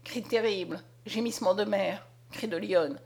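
usual speaking voice

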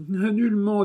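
high aggrieved marquise. Acted. Marquise answering the abbé, high and plaintive. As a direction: play a high-voiced noblewoman in grief.

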